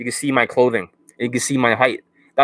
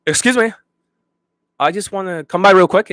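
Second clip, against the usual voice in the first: much higher and louder, words crammed together; mock rushed approach; 7 semitones higher, 5 dB louder than usual. Coach imitating students approaching women, high rushed patter. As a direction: blurt it out high like a jittery guy stopping a woman. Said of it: "That's how they talk like".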